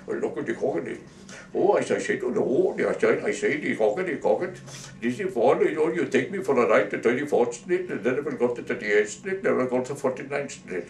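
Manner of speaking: hammed-up Indian accent. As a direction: put on a mock Indian accent. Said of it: "found out the Indian was an American citizen".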